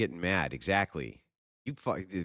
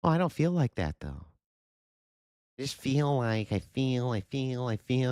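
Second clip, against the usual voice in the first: high, louder whiny mimicry; mocking whiny voice; 7 semitones higher, 4 dB louder than usual. Ridiculing people who only talk feelings, high whiny mimic. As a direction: pitch it way up to ridicule.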